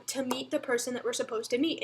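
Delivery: usual speaking voice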